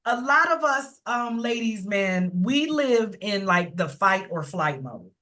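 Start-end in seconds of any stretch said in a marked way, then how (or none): none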